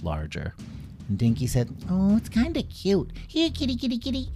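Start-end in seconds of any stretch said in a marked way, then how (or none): 1.9-4.3 very high cooing kitty call; sweet coaxing call. Acted. Dinky calling the giant snowcat, high coaxing coo. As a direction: coax the cat like a pet.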